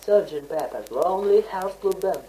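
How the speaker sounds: ordinary talk, nothing marked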